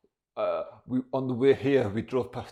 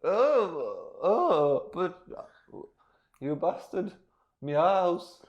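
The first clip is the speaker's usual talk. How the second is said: high-pitched, halting lament; raised voice of a woman wronged; mock distraught woman; 8 semitones higher, 3 dB louder, much slower than usual. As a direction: moan in hammed-up dismay. Acted woman lamenting her lost house.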